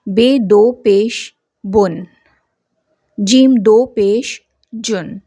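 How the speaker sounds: slow, syllable by syllable, rounding into बुन and जुन; slow Urdu spelling drill, tanween read as बुन and जुन. Shown as a demonstration. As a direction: spell out दो पेश slowly, then give बुन a round nun ending. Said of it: "नून की गोल आवाज़ ज़ाहिर करते हैं".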